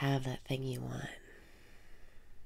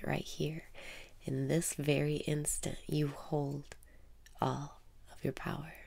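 guided meditation. Acted. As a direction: guide the listener inward, half whispering. Guided self-love meditation.

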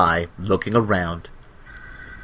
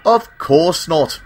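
slow, higher, cross retort; 7 semitones higher, 3 dB louder, slower than usual. A narrator's reading. Glyn snapping at Stanley, slow and cross. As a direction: bite back at the silly question. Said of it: "replied Glyn crossly".

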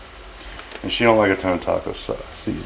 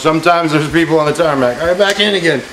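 playful airplane voice; 6 semitones higher, 5 dB louder than usual. Pretend. Airplane spoon game.

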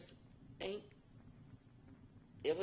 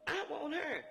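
retold demand; 11 semitones higher, 5 dB louder, slower than usual. Boss lady demanding the girl.

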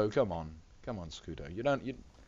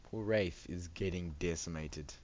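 ordinary talk

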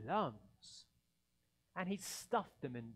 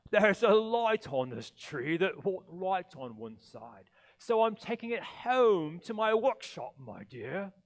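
sugary fake Santa, a bit louder; fake sweetness; 3 semitones higher, 11 dB louder than usual. Grinch as fake Santa lying to Cindy Lou, sugary. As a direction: lie sweetly to the child. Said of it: "the fake Santa Claus lied".

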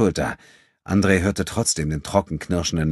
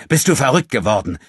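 higher and louder, snapping at him; barking demand; 7 semitones higher, 6 dB louder than usual. A narrator's reading. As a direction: demand an answer with force. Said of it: "herrschte er Abudun an".

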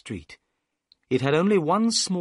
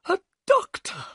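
very high, excited exclamation; excited cry; 3 dB louder than usual. A narrator's reading. Thaddeus learns a guest is a doctor, excited cry. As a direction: cry it out high, as the nervous little man. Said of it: "cried Thaddeus Sholto excitedly".

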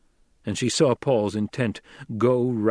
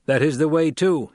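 higher, louder, grave desert chieftain; Fremen leader; 5 semitones higher, 5 dB louder than usual. A narrator's reading. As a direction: speak as the stern leader before the tribe. Stilgar speaks in council.

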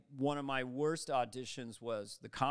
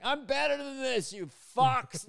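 high, loud indignant outburst; ranting actor; 9 semitones higher, 5 dB louder than usual. Retold or imitated. Actor leaning out of the helicopter, indignant shout. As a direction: shout it out at everyone below. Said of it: "lean out 'cause he's like".

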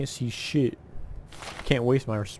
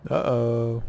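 normal talking voice

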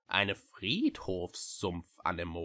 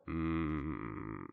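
low, grumbling hmm; low hum; 7 semitones lower, 6 dB quieter than usual. A narrator's reading. Witch pondering the book, low grumbling hum. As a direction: grumble it under your breath. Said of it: "brummte sie wieder".

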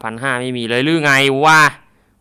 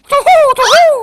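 shrill hooting war cry, much higher and louder; high battle cry; 8 dB louder than usual. Pretend. Gamer whooping as he slashes zombies, high war cry. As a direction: whoop like a charging samurai.

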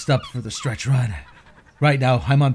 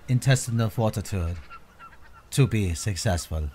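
hushed nature documentary; 3 dB quieter than usual. Acted. Narrator describes final stretch.

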